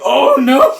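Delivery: hammed-up panicked cry through laughter; mock panic. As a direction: yelp it while laughing. Panicked character chased, with laugh.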